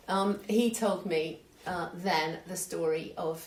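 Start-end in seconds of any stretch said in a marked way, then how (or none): none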